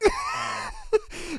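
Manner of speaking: a sudden shrill squeal; high shrieking laugh. Real, not acted. Streamer laughing at the cheater's logic, shrill laugh.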